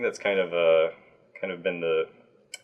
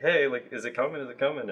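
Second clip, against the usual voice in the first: higher-pitched mock pestering; imitated eager pestering; 6 semitones higher than usual. Users poking the team about the release, mock pestering. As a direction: pester like an impatient user. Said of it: "poked us over and were like".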